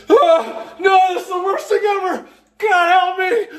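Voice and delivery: hammed-up terrified plea; mock horror. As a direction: wail in disgust and dread. Retold horror in hell.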